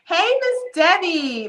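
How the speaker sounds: high, slow, drawn-out greeting; stretched high hello to a member; singsong greeting. Real, not acted. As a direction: sing her name out warmly.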